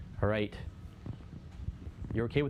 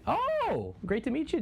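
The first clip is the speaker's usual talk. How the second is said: delighted greeting; slower than usual. Real, not acted. Greeting viewer from Japan.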